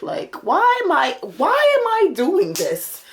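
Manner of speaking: high, slow drawn-out why; exasperated whine. Retold or imitated. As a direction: plead in disbelief. Exasperated complaint to herself.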